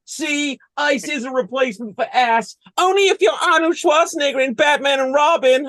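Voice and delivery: put-on high-pitched know-it-all child; explaining kid. Kid explains the ice pun to the team, high voice.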